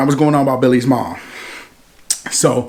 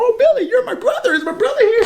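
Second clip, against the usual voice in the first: put-on very high, giggly little girl voice; sweet little girl. Little sister greets Billy at the door, high and giggly. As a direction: gush like an excited tiny girl.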